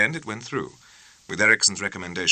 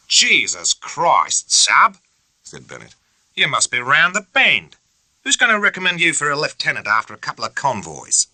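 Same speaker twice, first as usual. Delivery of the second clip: louder, higher, mocking scorn; sarcastic bosun's sneer; scornful officer; 8 semitones higher, 6 dB louder than usual. A narrator's reading. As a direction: scoff at him. Bennett scornful.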